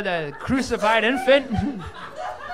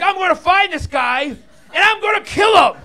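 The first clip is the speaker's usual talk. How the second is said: high, loud furious shout; angry kid threat; 8 semitones higher, 6 dB louder than usual. Retold or imitated. Upset son vowing revenge on the pie thief, high angry shout. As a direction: yell it like a vengeful little boy. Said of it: "He's like".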